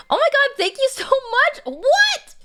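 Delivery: high and loud thrill; excited. Real, not acted. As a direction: gush it out in delight. Reacting to gifted subs.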